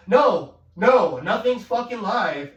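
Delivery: loud, slow, fed-up retort; no, nothing's live, loud and annoyed; exasperated outburst. Real, not acted. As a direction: shout down the idea.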